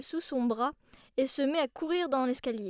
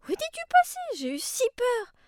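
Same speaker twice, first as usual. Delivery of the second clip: relieved worry; 10 semitones higher, 4 dB louder than usual. Acted. Worried grandmother finds child.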